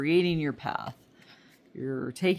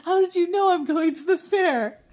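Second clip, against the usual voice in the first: much higher, louder, stunned; amazed shout; 14 semitones higher, 10 dB louder than usual. Retold or imitated. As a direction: cry out like you've been caught. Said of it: "one little thing where I'm like".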